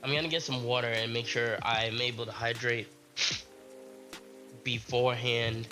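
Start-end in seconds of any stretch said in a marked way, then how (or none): none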